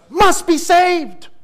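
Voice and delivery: preacher proclaiming salvation to the church; fervent preaching. Real, not acted. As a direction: drive the truth home with full force. Climax of scripture quote.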